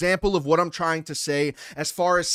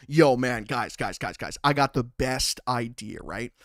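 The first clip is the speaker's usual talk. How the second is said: lower-pitched mock schemer pitching a plan; lightly voiced buddy with a big idea; a hint of a plotting friend; mock scheming friend; 7 semitones lower than usual. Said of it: "can you imagine going".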